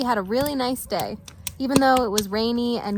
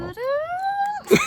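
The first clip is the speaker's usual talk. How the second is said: very high, eerie wailing cry; loon call; 3 dB quieter than usual. Pretend. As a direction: wail it like a loon at dusk. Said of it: "just give us one loon call".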